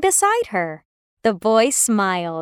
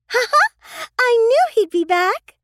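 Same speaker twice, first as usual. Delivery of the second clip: put-on gleeful girl; 12 semitones higher than usual. Sister hearing the bear return, high gleeful girl. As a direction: crow with joy he returned.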